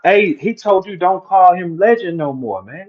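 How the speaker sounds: usual speaking voice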